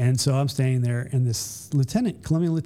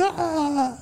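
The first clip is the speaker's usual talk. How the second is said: panicked cry. Retold or imitated. Jumper panics running up.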